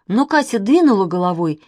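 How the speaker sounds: ordinary delivery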